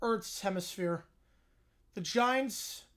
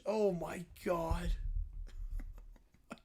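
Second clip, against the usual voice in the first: usual speaking voice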